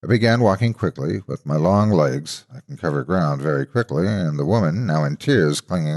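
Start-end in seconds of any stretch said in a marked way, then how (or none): none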